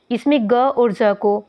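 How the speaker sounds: usual speaking voice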